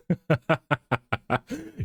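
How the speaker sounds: real high, rapid giggle; laughing. Real, not acted. Animator cracks up at own elevator band joke, laughing. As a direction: burst of high staccato laughter.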